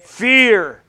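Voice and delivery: slow, rising preacher's cadence; preaching. Acted. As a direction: preach it with fervor. Preaching on Jehovah Shalom.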